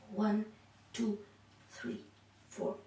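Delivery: normal talking voice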